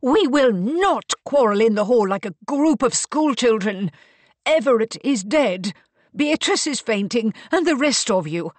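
high, loud, imperious reprimand; stern scolding. A narrator's reading. Mariana rebukes her family in the hall, sternly. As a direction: scold them like a strict matriarch.

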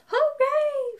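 high cheering "Hooray!". Acted. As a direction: cheer for the little truck.

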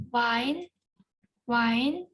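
Вайн with a rising tune; Korean pronunciation. Shown as a demonstration. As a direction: model Вайн. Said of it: "я прочитаю по декілька разів слово". («Вайн» з висхідною інтонацією)

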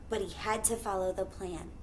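ordinary delivery

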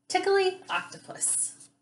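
title in a very high, playful voice; high title call. Shown as a demonstration. Teacher announces the cute book's title to children, high and playful. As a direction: lift the whole title way up high.

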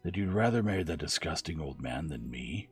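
usual speaking voice

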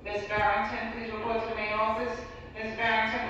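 ordinary delivery

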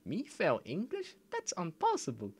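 put-on squeaky kid voice; mock Ralph Wiggum line. Quoting Simpsons line in voice.

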